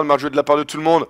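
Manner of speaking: ordinary delivery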